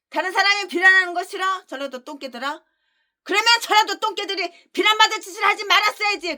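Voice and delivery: high, fast scolding; mock them, then slam the verdict; taunting rebuke. Real, not acted. Preacher taunting critics who hate blame.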